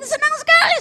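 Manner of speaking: high, loud burst of delight; elated exclamation. Retold or imitated. Preacher telling of a man's promotion, high excited shout. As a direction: crow it out with joy.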